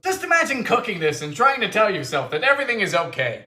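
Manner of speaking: ordinary talk, nothing marked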